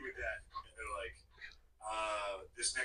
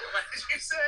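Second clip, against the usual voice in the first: put-on surprised girlfriend; 13 semitones higher, 7 dB louder than usual. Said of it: "she's like".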